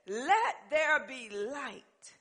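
very slow, solemn divine command; slow voice of God. Acted. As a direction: speak as God creating light. God speaks in Genesis.

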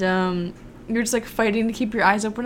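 usual speaking voice

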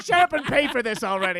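high, loud mock impatience; impatient retort. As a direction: cut in as the fed-up character. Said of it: "He's like".

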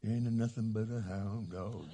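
low, soft crooning [name] voice; [name] impression. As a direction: croon it like the King. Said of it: "All you [name] lovers".

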